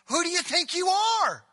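high, loud indignant outburst; indignant challenge. Acted. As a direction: fling the question at him in outrage. And then I love this line.

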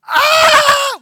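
loud, high-pitched shriek of fright; sudden scream. Acted. Preacher acting out the witch seeing Samuel, startled scream. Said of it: "she screamed".

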